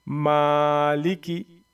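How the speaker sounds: very slow, first vowel held; drawn out recitation. Shown as a demonstration. Teacher reads the word slowly for students. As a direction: draw Maliki out long. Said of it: "इसको ऐसे क्यों पढ़ा मैंने?".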